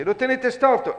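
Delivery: high, loud warning to the queen; alarmed Alice. A narrator's reading. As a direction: point it out in a hurry. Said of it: "disse Alice".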